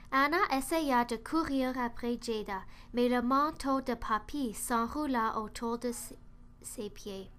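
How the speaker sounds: ordinary delivery, nothing marked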